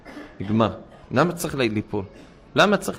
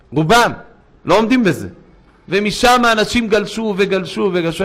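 raised, insistent sermon voice; forceful preaching; 4 semitones higher, 4 dB louder than usual. Acted. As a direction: preach it hard to the crowd. (דרשה בקול רם ונלהב)

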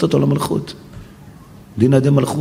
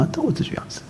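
whisper; 11 semitones higher, 11 dB quieter than usual. Real, not acted. Pleading for the sinner.

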